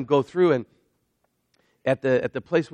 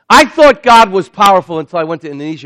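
bursting out louder and higher; excited; 6 semitones higher, 15 dB louder than usual. Real, not acted. Preacher telling the crowd what he learned in Indonesia, excited and loud. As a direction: erupt with real excitement about God's power. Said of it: "I get a little excited".

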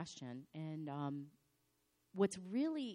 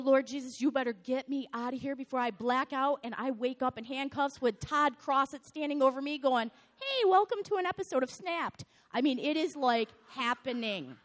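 loud, high, frantic rant; loud, high, exasperated plea to the Lord; half-shouted, frantic venting; exasperated outburst; 8 semitones higher, 13 dB louder than usual. Acted. Exasperated outburst retelling the fury.

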